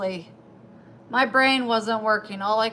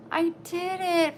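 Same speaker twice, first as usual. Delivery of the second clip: proud quote; 9 semitones higher than usual. Retold or imitated. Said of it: "I'm like".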